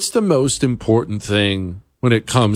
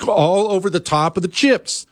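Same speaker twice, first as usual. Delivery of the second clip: machine squirt sound; 5 semitones higher than usual. Shown as a demonstration. Showing how the cheese machine sounds, a squirt noise. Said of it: "That's how it sounds".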